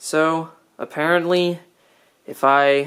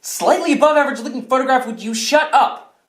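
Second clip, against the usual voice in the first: fast, high, irritated rant; exasperated outburst; 7 semitones higher than usual. Acted. Silencing a companion mid-negotiation, exasperated. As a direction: cut them off, rapid and high.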